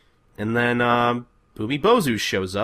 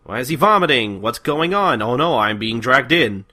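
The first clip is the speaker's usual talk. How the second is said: louder, pitched-up flustered Kagura; mock panic; 4 semitones higher, 6 dB louder than usual. Kagura being dragged into the monster, mock panicked. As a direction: babble in rising comic alarm. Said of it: "And Kagura's like".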